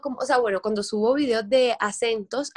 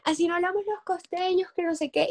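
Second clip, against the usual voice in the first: indignant commenter; 7 semitones higher than usual. Retold or imitated. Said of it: "Imagínate que me decían".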